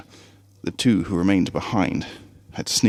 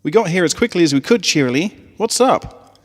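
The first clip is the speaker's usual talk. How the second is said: brash tomboy voice; 9 semitones higher, 5 dB louder than usual. A narrator's reading. Rainbow Dash arriving in a rush.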